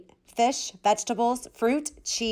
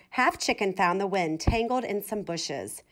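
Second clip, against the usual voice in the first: usual speaking voice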